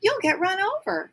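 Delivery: high, loud, alarmed warning; fussing duck mother, frightened for her mate; flustered squawk. A narrator's reading. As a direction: cry it out in a dither. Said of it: "all of a dither".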